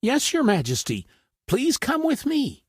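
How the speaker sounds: high, obliging little rabbit voice; a clever rabbit leading on; eager rabbit. A narrator's reading. Rabbit leading the lion, high and eager. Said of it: "replied the rabbit".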